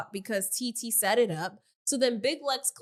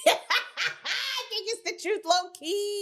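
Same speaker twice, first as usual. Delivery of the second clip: laughing; 10 semitones higher, slower than usual. Real, not acted. Laughing while giving her opinion.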